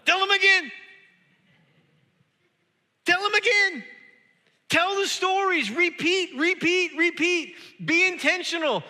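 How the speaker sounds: very high, shouted exhortation; full-force preaching. Real, not acted. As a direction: hammer each repeated command home at the top of your voice.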